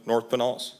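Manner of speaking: ordinary talk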